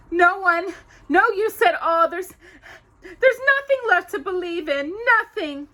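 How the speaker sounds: high, loud, tearful Cinderella; despairing girl. Acted. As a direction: plead through the tears.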